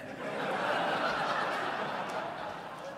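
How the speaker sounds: quiet breathy laugh; soft laugh. Real, not acted. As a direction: laugh softly under breath. Laughing at own story.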